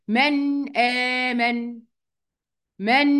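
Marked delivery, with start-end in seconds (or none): 0.1-1.8 من آمن very slow, noon of من fully sounded before the hamza; slow tajweed من آمن; clear izhar. Shown as a demonstration. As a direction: recite من آمن slowly with a full noon. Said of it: "يبقى هتبقى إيه؟".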